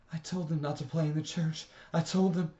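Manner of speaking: a mother close to screaming; frantic mother about to scream; near hysteria. A narrator's reading. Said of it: "looked like she was going to start screaming".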